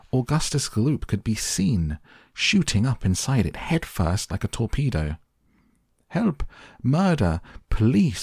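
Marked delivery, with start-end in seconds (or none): 6.1-8.2 shrieked alarm, moderate volume; high mock scream. A narrator's reading. Mother screaming as son shoots up pipe, high panic. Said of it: "screamed Missus Gloop".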